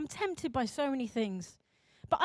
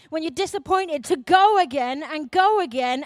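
loud, building preacher's cry; preaching at full force; 2 semitones higher, 9 dB louder than usual. Acted. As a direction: drive the congregation on, louder with each line. Rising exhortation to congregation.

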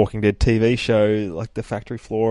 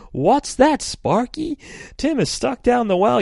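very high, loud mock alarm; hammed-up question to a clever dog; Lassie-style TV hero; 4 dB louder than usual. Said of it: "definitely a Lassie style thing".

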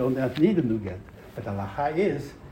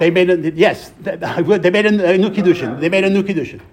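loud, forceful correction; insistent answer; 4 semitones higher, 9 dB louder than usual. Real, not acted. Teacher answering a question on the get, insistent answer. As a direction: override the objection, loudly.

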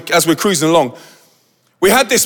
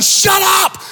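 high-pitched mock yell; hammed-up furious outburst; angry shout; 14 semitones higher than usual. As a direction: yell it like an exasperated passenger. Passenger yells back, acted out.

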